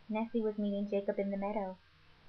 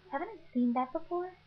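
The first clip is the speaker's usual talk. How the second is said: puzzled Nessie; 8 semitones higher than usual. Retold or imitated.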